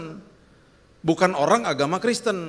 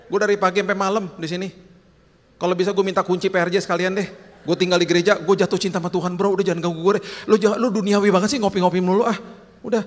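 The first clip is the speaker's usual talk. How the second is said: normal talking voice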